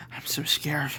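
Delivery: soft, whispered fear; scared whisper. Acted. Edward, unable to move, whispers scared. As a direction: whisper it in fright. Frightened character admits fear.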